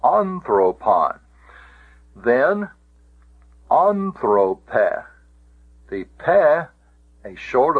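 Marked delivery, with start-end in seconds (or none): 0.0-1.2 slow Anthropon, weight on the first syllable "An"; stress on antepenult. Shown as a demonstration. Teacher confirming the accent's place, Anthropon stressed on "An". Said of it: "indeed that's where it is". 3.7-5.0 anthrope with first syllable "an" stressed; stress on antepenult. Shown as a demonstration. Teacher showing the next case, anthrope stressed on "an". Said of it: "allows the accent to go back".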